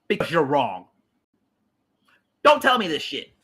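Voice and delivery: ordinary talk, nothing marked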